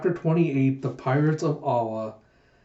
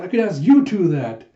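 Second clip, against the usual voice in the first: indignant retort; 4 semitones higher, 6 dB louder than usual. Acted. Huck retorting to Yun.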